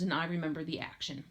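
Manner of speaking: ordinary delivery, nothing marked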